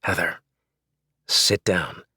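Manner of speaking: husband ordering wife to sit, quietly firm; quiet authority. Acted. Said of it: "mustered all the authority ... without raising my voice".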